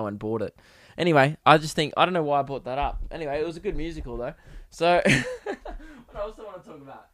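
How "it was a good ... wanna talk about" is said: ordinary talk, nothing marked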